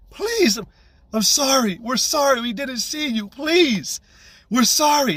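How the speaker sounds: high-pitched imitation of a pleading mother; begging. Mother begs him to spare her son, high retold pleading. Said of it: "she's looking at me begging".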